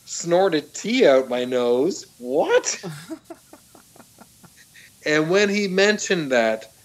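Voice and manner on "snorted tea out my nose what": slow, higher, stunned question rising into a squeak on what; baffled, can't believe what he just read; incredulous disbelief. Retold or imitated. Hearing he snorted tea, asking in disbelief. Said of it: "And I was like".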